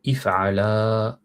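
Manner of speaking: if'ala said very slowly, each syllable drawn out; slow Arabic model word; model pronunciation. Shown as a demonstration. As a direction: spell out if'ala slowly like a teacher. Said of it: "Vous deux".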